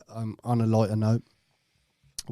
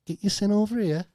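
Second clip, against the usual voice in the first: mock Yorkshire call, much higher; a Yorkshire mum calling her son, pitched up; Yorkshire accent; 9 semitones higher than usual. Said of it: "that Yorkshire accent".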